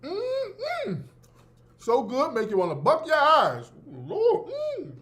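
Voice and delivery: high, delighted humming over food; delighted mmm. Real, not acted. Savoring a cookie.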